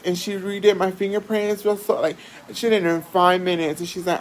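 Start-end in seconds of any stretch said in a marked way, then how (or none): none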